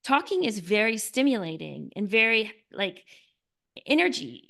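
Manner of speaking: usual speaking voice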